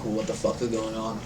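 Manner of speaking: very soft, low undertone; hushed, puzzled aside; whispering nobles. Acted. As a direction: murmur it among the court. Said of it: "whispering amongst themselves".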